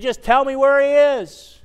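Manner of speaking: high, loud desperate begging; a grieving woman crying out to strangers; tearful plea. Acted. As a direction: plead through tears for the body. Mary begging at the empty tomb.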